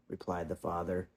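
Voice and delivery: ordinary delivery, nothing marked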